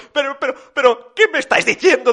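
high stammering dismay; faint with shock. A narrator's reading. Papá Noel about to faint at the news, high stammering. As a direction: stutter upward into disbelief.

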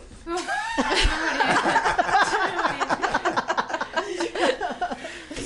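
high giggling laugh; long laugh. Real, not acted. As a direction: laugh for a while.

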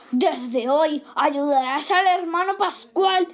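high-pitched thundering command; thunderous shout. Acted. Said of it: "gritó con voz de trueno".

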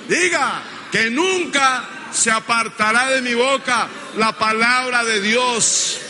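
high, full-force pulpit declaration; insistent, drawn-out preacher's phrases; preaching call-and-response. Real, not acted. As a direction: lead the congregation in a bold vow. Preacher leading congregation in declarations.

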